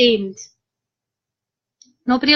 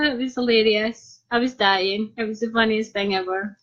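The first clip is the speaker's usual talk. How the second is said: normal talking voice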